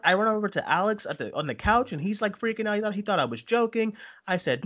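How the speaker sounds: ordinary talk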